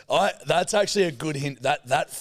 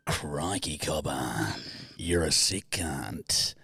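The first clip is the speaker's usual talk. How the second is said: much lower, hammed-up Aussie accent; mock sexy Australian; 8 semitones lower, slower than usual. Joking how an Aussie would flirt abroad, in thick Australian. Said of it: "Trying to do it in a sexy voice".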